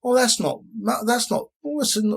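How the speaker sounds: lightly voiced sceptic brushing it off; mock viewer voice.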